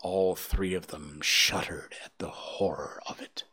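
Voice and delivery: hushed, shuddering narration; whispered narration. A narrator's reading. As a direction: tell it in a horrified hush.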